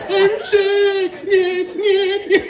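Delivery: loud, high mock whine; squealed, babyish complaint; whining baby man. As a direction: whine like a sick big baby. Mimics whiny baby man.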